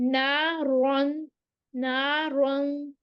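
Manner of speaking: نارن with a thick, full را and long madd; heavy ra. Shown as a demonstration. Teacher models the heavy ra in نارن. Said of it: "اس کو پھر ہم موٹا پڑھیں گے".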